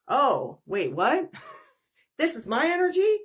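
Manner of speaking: acted disbelief, rising high at the end; surprised realization. Client hearing the energy is their own, surprised. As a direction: play startled, rising into an incredulous question.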